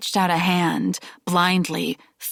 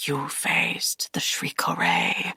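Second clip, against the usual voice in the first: low, slow hoarse whisper; awed hoarse whisper, much lower; slow hushed rasp in awe; 13 semitones lower, 4 dB quieter than usual. A narrator's reading. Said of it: "his voice a hoarse whisper".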